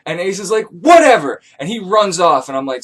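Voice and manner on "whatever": loud, sulky dismissal; bratty whatever. Retold or imitated. Ace brushes off the narrator, loud and bratty. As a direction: storm off like a teenager.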